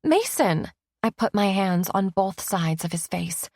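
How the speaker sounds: plain reading voice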